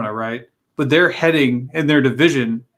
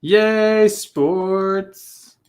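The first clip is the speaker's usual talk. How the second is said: mock cheer, then deflated; sarcastic yay; 6 semitones higher than usual. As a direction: fake enthusiasm that drops off.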